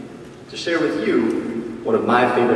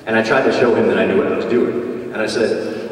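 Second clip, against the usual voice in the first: ordinary talk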